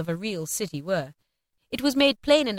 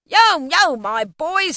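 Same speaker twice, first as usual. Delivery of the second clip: high, loud, hearty call; booming cheery old master; jovial hail; 8 semitones higher, 9 dB louder, much slower than usual. A narrator's reading. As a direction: hail the lads merrily. Fezziwig hails his apprentices.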